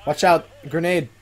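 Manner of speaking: loud high alarmed shout; shouting warning. Real, not acted. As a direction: yell the warning to your teammate. Warning teammate of grenade.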